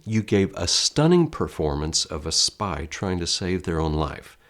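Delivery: usual speaking voice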